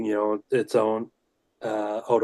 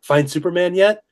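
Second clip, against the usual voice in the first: put-on higher, rising kid-like pestering; eager retold question; 7 semitones higher than usual. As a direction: ask hopefully, lifting right up on "yet". Retelling his repeated childhood question.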